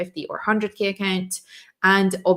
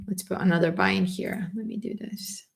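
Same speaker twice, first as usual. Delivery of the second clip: whisper; 6 dB quieter than usual. Real, not acted. Muttering to herself while clicking.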